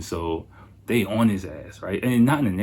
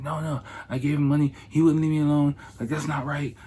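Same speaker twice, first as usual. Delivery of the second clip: higher-pitched imitation of a man justifying himself; protesting; 7 semitones higher, faster than usual. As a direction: plead your case, pitched up. Acts colleague's protesting self-justification.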